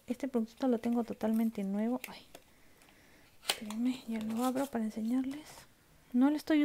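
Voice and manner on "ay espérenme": ordinary talk, nothing marked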